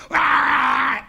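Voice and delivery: high, loud engine noise; mock engine revving. Patient on the bed imitating a Ferrari engine. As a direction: rev like a car.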